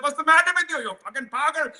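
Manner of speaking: high, mock-indignant Indian subcontinent accent. The street stranger scolding the speaker, in a high mock Indian subcontinent accent. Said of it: "I went a bit Indian subcontinent there".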